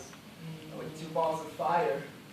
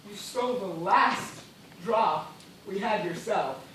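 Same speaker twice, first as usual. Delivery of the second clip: ordinary delivery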